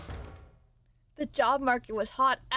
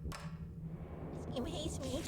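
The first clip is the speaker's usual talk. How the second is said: a slow, much softer sulky complaint; soft slow whine; 3 semitones higher, 14 dB quieter, much slower than usual. Real, not acted. Streamer sulks that the game hates her, soft and slow. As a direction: moan it softly to yourself. Whiny aside to chat.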